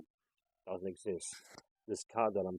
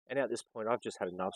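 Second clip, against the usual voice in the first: ordinary delivery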